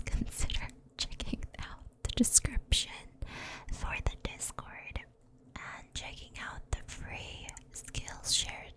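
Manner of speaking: hushed, intimate ASMR outro whisper; soft whisper. Real, not acted. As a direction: whisper the sign-off close to the mic. Whispered outro after story.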